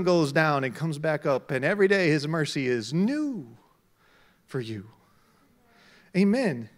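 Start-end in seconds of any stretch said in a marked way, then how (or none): none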